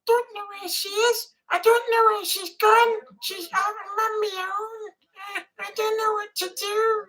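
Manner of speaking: put-on high, childlike voice of a lost kid; Tiny Tim impression. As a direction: whimper like a child who lost his mum. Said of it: "I can impersonate him".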